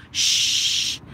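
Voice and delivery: long drawn-out shush; crowd shushing. Retold or imitated. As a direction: imitate spectators hushing someone. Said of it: "when the people go".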